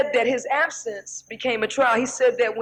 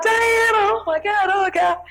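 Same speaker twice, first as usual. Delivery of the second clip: crowd yelling; 7 semitones higher than usual. Retold or imitated. A crowd calling out to [name], high excited squeals. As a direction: scream her name like a fan. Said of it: "everybody's yelling out".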